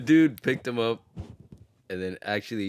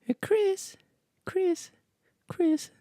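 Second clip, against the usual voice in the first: very high, breathy falsetto calls; much slower than usual. Retold or imitated. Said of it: "who sounds like this?".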